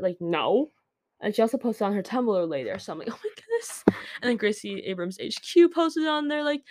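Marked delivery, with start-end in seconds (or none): 3.1-3.8 tiny, very high, soft squeal; retold thrilled squeal. Fan reacting to a second post, thrilled squeal. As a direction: squeak it out, overcome with excitement.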